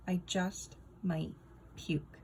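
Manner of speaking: ordinary delivery, nothing marked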